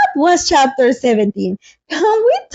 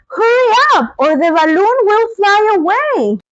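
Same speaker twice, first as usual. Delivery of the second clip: urgent wizard; 7 semitones higher than usual. Acted. Wizard urging Dorothy.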